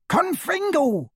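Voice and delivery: very high, drawn-out screamed spell. A narrator's reading. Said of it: "Hermione screamed".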